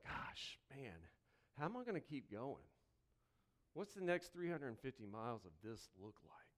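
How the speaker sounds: a worn-down parent doubts they can go on, softly; weary quiet lament. Retold or imitated. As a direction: sound spent and worried under your breath. Voicing a tired listener's worry.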